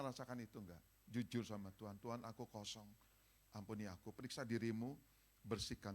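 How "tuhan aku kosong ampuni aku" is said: soft prayer. Shown as a demonstration. Sample confession prayer.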